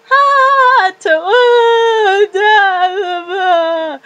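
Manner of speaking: put-on high, loud drunken giggle then sloppy singsong; drunk sounds. Retelling her drunk night, loud sloppy drunk voice. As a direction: play the wasted girl losing it. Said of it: "I go from".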